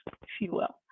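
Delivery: whispered aside. Real, not acted.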